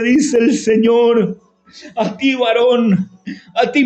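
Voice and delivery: usual speaking voice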